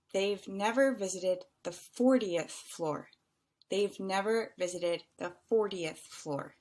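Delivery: measured words, "fortieth" set apart. Shown as a demonstration. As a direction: space the words out and articulate "fortieth". Teacher slowly repeats the answer.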